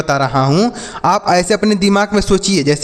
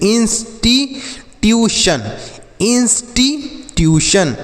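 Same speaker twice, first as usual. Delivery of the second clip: Institution stretched slowly, higher; slow drawn-out demo; 5 semitones higher, slower than usual. Shown as a demonstration. Teacher to pupils, modelling the word, slow and drawn out. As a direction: read institution slowly so every syllable lands. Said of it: "देखिए कितना आसान हो जा रहा है पढ़ना".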